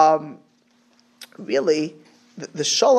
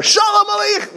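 much higher and louder, bursting with warmth; big hearty hello, pitched way up; emphatic greeting; 6 dB louder than usual. Shown as a demonstration. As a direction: greet them like you're thrilled to see them. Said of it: "it was so emphatic".

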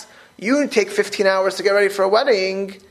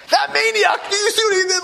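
high-pitched outraged driver's shout; hammed-up furious yell; mock road rage. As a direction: imitate a driver yelling in disbelief. Imitates an enraged driver in car.

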